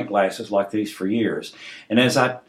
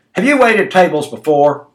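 loud, high hammering question; shouting; 6 semitones higher, 6 dB louder than usual. A narrator's reading. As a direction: shout it at them like an interrogation. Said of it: "only spoke in one tone, shouting".

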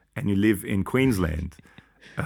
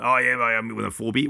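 mock Aussie bloke. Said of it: "which is a very Australian thing to say".

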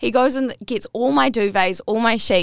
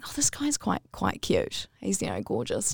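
dreamy thought; 3 semitones lower, 9 dB quieter than usual. Retold or imitated. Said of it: "went to sleep going".

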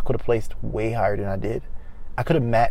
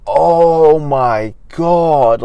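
excited; 4 semitones higher, 9 dB louder, much slower than usual. Retold or imitated. Learning he passed.

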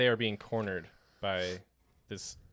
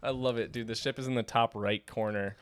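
usual speaking voice

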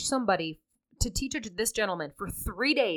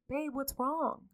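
soft, slow, raised voice, rising at the end; gentle concern; 6 semitones higher, 9 dB quieter, slower than usual. Shown as a demonstration. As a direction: ask tenderly, drawing it out. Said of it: "The tone of which somebody says something matters".

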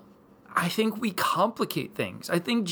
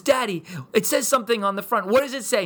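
put-on high, eager little-girl voice; excited child; 7 semitones higher, 4 dB louder, faster than usual. Daughter shows dad the balloon, excited. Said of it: "she's so excited".

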